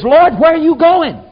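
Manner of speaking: high, loud and urgent; excited outburst. Retold or imitated. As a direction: blurt it out like the house is on fire. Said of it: "It means excited".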